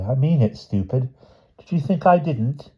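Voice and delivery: tease, ending on a high question; mocking. A narrator's reading. The boy answering Charlotte as he leaves, mocking. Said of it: "he was mocking again".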